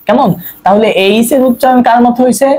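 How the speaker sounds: ordinary talk, nothing marked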